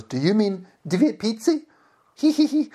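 high girlish put-on voice, tittering; mock a giggling server, pitched high; imitated giggly woman. Server correcting his Russian grammar, giggly mimicry. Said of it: "And she giggles".

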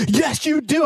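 insistent nurse. Retold or imitated.

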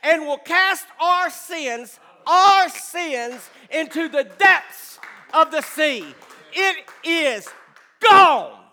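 slow, loud, ringing pulpit cry; preaching at full force. Acted. Pastor proclaiming sins cast into the sea, full preaching force. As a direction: preach it out to the congregation.

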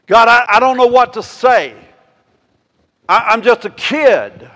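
high, loud, disbelieving protest; preacher voicing Jeremiah's panicked refusal; frantic young prophet. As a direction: beg God to pick someone else. Jeremiah protesting to God again.

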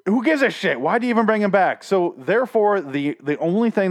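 high, loud exasperated outburst; getting mad. Real, not acted. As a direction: rant as your temper rises. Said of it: "now I'm getting mad".

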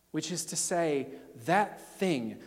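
normal talking voice

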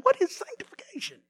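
mildly mocking a confused listener; puzzled coworker. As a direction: ask like it's a foreign word. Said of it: "that they're going".

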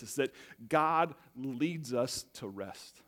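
slow, deliberate key line; slow emphasis. Real, not acted. Pastor to congregation, slow emphatic main point. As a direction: lay down the big idea word by word. Sermon big idea stated slowly.

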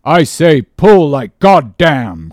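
loud, slow, emphatic answer; forceful cry. A narrator's reading. Harpooneer answering his mate, forceful cry. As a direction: belt it out word by word. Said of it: "cried the Indian".

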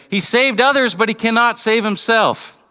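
high-pitched mocking taunt; mock him as a scornful onlooker. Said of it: "mocked him and said".

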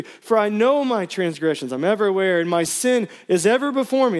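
pleading psalm read with rising intensity; impassioned scripture reading. Acted. Pastor reading a psalm to church, pleading. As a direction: preach the plea as your own. Preacher reads the psalm aloud.

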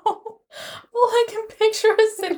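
very high voice squeezed by laughter; helpless laughter. Real, not acted. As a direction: struggle to talk through real giggles. Helpless laughing fit while explaining.

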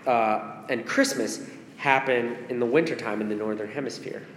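usual speaking voice

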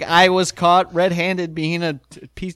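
normal talking voice

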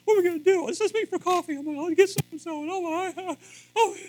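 imitating flustered girls. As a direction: squeal like frantic girlfriends. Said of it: "The girls are like".

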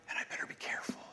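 a whispered aside to himself. Real, not acted. Preacher cautioning himself before the congregation, whispered. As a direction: breathe it as a private warning.

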